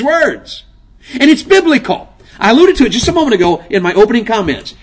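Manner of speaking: loud, high fiery preaching; full-voiced revival sermon; preaching at full force. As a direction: call it out to the congregation.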